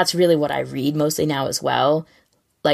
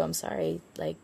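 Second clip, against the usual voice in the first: much softer, breathy laughing sorry; laughing apology; 13 dB quieter than usual. Real, not acted. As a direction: apologize through a quiet laugh.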